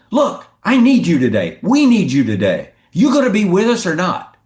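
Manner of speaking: retold coach challenging a fan; imitated coach confrontation. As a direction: press him hard for a commitment. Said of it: "Joe looks at the guy and goes".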